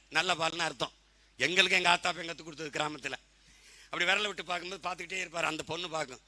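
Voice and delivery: normal talking voice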